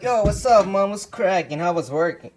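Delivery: breezy call out to mom; casual greeting. Retold or imitated. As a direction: son greeting his mom who isn't answering, upbeat. Greeting mom who doesn't answer.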